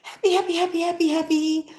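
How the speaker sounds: squeaky high mock cat, bouncing with glee; happy cat voice. Mimicking the jumping cat in a reel, high squeaky glee. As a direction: imitate a gleeful cartoon cat, way up high. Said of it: "And he's like".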